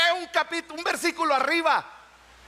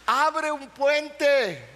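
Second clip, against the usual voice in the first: slow emphasis; much slower than usual. Real, not acted.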